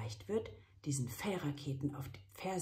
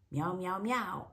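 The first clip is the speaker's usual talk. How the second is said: proud meows; 5 semitones higher than usual. Acted. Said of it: "freut sich voller Stolz".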